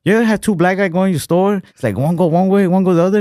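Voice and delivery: high, put-on Chinese shopkeeper voice; high-pitched imitation of a Chinese comic on stage. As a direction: imitate the cartoon comedian. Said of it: "He's like".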